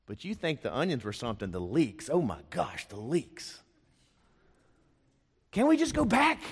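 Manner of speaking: mock them pining for leeks; preacher mocking Israelites craving Egypt's food; whiny Israelite. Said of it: "let me make fun of them".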